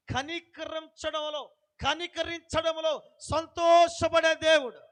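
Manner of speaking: preacher exalting God, drawn out and loud; exultant praise. Acted. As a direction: savour every word of God's mercy. Exalting God's mercy.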